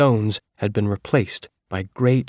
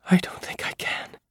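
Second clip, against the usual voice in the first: shaky, frightened whisper; trembling whisper; 5 dB quieter than usual. Acted. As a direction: breathe it out, trembling with fear. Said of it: "Her voice was a trembling whisper".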